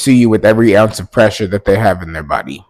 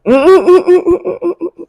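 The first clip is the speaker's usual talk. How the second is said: squealing laugh, high and loud; 8 dB louder than usual. Real, not acted. Vlogger laughing to viewers after her mother's call, high. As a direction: burst into a high giggle.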